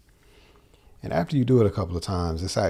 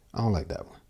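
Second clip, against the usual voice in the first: ordinary talk, nothing marked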